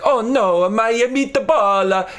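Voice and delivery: high, loud cartoon Italian chef; Italian accent. Comedian imitating the cartoon Italian chef, dismayed Italian accent. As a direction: wail it in a hammed-up Italian accent. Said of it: "who's Italian. He's like".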